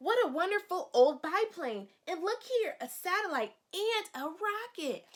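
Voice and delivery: high, loud delighted storytelling; excited read-aloud. Acted. Teacher reading to children, thrilled by the biplane. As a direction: marvel at each plane and rocket.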